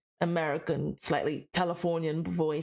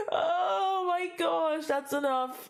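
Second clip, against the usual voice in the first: high, very slow embarrassed whine; mortified wail; 8 semitones higher, much slower than usual. Real, not acted. Streamer has had enough of the accent, mortified high wail. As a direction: wail it out, begging to stop.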